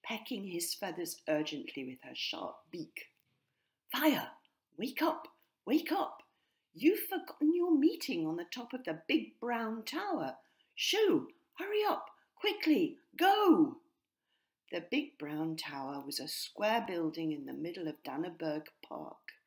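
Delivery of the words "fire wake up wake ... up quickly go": high, loud, urgent squawking; a bird shrieking an alarm; urgent wake-up call. A narrator's reading. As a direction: rouse him in a panic. Hex urgently wakes Fire.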